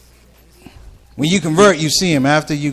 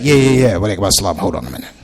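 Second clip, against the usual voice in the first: suspicious guard; 8 semitones lower, faster than usual. Retold or imitated. Masjid security guard stopping the comic, low stalling. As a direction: brush him off, hold him back.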